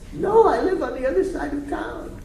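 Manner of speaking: a hammed-up distraught wife; imitating the crying woman. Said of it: "I said to her".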